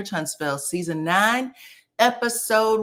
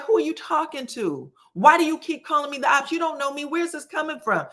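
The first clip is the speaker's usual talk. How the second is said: higher-pitched, indignant retelling; pissed; 8 semitones higher than usual. Retold or imitated. Tisha, already drunk, answering Shanita, mock pissed protest. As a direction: challenge them, imitating someone riled. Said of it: "Tisha is pissed".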